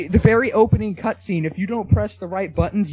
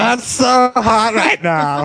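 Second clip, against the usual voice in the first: mock sexy boast; 4 semitones higher, 4 dB louder, slower than usual. Joke boast, put-on voice.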